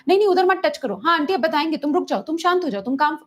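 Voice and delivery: high, rushed scolding orders; bossy commands. Shown as a demonstration. Therapist demonstrates stressful rapid commands to a child, high and bossy. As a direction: fire commands at the child one after another. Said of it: "अगर मैं इस तरीके से बात कर रही हूँ".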